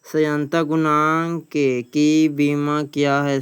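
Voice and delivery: ordinary talk, nothing marked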